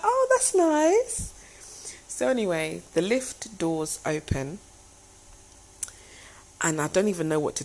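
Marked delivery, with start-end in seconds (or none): 0.0-1.3 high, drawn-out polite approval; squeaky attempt at playing it cool; retold feigned composure. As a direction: act politely pleased, holding the hype down. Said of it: "I'm holding it down in my spirit".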